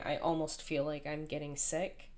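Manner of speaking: ordinary talk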